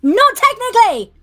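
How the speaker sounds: high, very loud mock protest; hammed-up defiant holler; shouted retort. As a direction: yell back in self-defense. Player shouts back at betrayal accusation.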